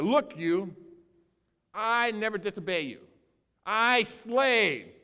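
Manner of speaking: put-on older son protesting to his father, indignant; indignant complaint. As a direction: throw it back at his father.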